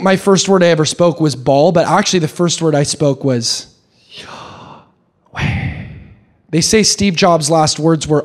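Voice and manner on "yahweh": "Yahweh" as pure breath, inhaled and exhaled, no voice; breathed pronunciation. Shown as a demonstration. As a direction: breathe "Yahweh" slowly, one whispered breath per syllable. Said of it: "breathe the name of God".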